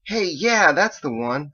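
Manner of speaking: excited recognition. A narrator's reading. Roommate hears the song he knows, excited.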